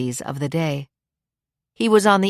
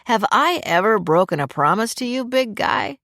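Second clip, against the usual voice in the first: playfully stern grandpa; mildly stern, affectionate questioning; mock severity; 5 semitones higher than usual. A narrator's reading. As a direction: challenge the child with pretend sternness. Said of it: "demanded with mock severity in his voice".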